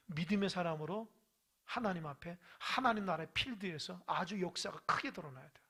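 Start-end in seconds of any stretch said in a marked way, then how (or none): none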